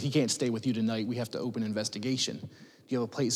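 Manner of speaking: gently delivering hard news; tearful. Retold or imitated. Social worker telling foster dad the baby must leave, softly tearful. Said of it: "our social worker's in tears".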